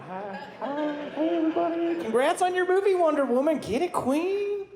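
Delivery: put-on Southern accent gone high and sassy, slowing on the last words; gushing. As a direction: gush over Wonder Woman like a superfan. Gushing greeting to Wonder Woman.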